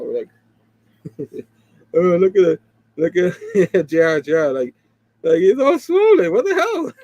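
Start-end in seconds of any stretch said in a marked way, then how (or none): 1.9-6.9 louder, laughing mockery; mock teasing. Mocking Peter's beat-up face, loud and laughing.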